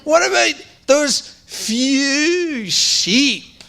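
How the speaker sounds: slow sneer with a laugh, rising high; laughing scorn at a younger brother; mocking big brother. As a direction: taunt him like a little brother. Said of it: "how I would say it to my little brother".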